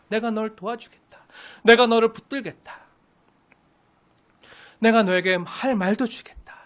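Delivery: soft, tender reassurance; gentle encouragement. Pretend. God speaking tenderly to Moses, soft and reassuring. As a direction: hush and reassure him like a father.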